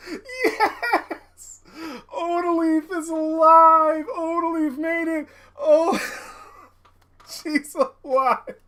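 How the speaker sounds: very high, tearful, laughing relief; overcome with emotion. Real, not acted. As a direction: squeal slowly through happy sobs. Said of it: "Too many emotions".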